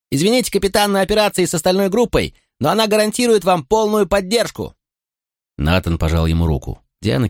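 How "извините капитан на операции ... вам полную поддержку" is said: put-on high, raised young voice over the rotor; shouting over noise. A narrator's reading. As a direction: call out eagerly over engine noise. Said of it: "перекрикивая шум вертолёта".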